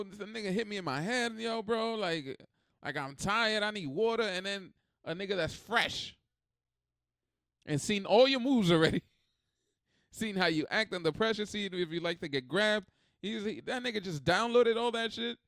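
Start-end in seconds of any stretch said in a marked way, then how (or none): none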